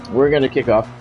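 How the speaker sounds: usual speaking voice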